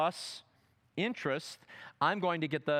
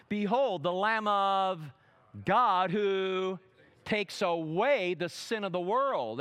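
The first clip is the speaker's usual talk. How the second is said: preaching at full force; 3 semitones higher, 5 dB louder, slower than usual. Acted. Preacher to congregation, rising proclamation. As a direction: proclaim it to the crowd. Preacher quoting John the Baptizer.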